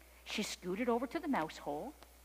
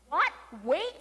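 indignant kitten; 7 semitones higher, 7 dB louder than usual. A narrator's reading. Kitten protests at being told to wait.

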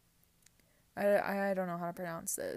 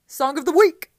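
hyped segment shout; 8 semitones higher, 18 dB louder than usual. Acted. Host hyping the final song of the week with a loud, high shout. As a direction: belt the title out high and loud.

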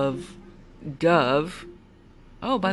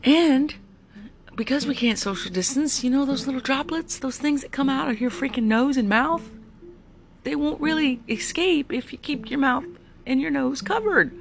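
very high-pitched exasperated insistence; exasperated lecture; 10 semitones higher than usual. Real, not acted. Driver lecturing riders on covering nose and mouth, exasperated. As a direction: spell it out, voice climbing in exasperation. Ranting about mask rules.

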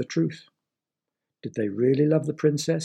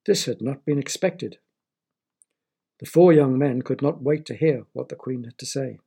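ordinary delivery

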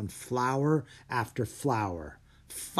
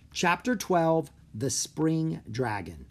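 ordinary delivery

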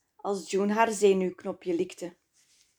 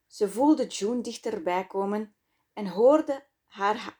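ordinary delivery, nothing marked

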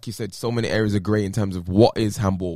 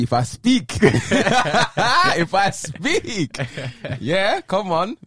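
high, laughing catchphrase delivery; laughing mock quote; 6 semitones higher, 3 dB louder, much slower than usual. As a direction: laugh through the quote, pitched up. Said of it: "my quote is gonna be".

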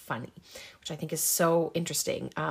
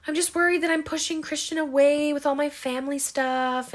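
higher-pitched imitation of tearful worry; mock tearful; 8 semitones higher than usual. Girlfriend crying on the phone to a friend, mock tearful. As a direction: imitate a worried, crying girlfriend on one high line. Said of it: "she's, like, crying, and she's like".

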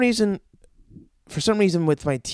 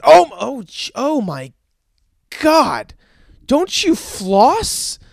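loud, high, aghast dentist; mock horror; 6 semitones higher, 5 dB louder, slower than usual. Dentist looking into the patient's mouth, hammed-up horror. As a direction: recoil aloud, stretching out the appalled question. Dentist horrified on seeing teeth.

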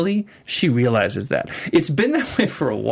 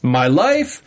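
higher-pitched mock desperation, building; acted defiant ultimatum; 8 semitones higher than usual. Host voicing a mother with nothing to lose, acted defiance. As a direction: list it off, then throw it all away. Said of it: "and so she's like".

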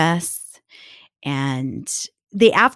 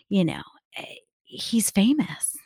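whispering; 3 dB quieter than usual. Real, not acted. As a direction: breathe it like a secret. Whispered aside.

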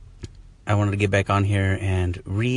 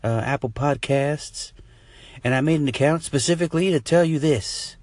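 slow, higher caller voice building to a jeer; heckling listener voice; 5 semitones higher, slower than usual. Pretend. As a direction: play a rude caller. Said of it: "You could be like".

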